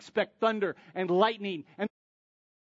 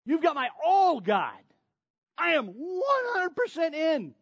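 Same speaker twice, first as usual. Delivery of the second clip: higher, hammed-up overeager boast; mock zeal; 9 semitones higher, 4 dB louder than usual. Believer boasting devotion to God, hammed-up and higher. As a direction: play the self-righteous zealot, overdone.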